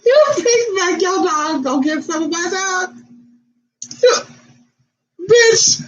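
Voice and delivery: put-on screeching at the owner whose old dog wants to mate; outraged yell.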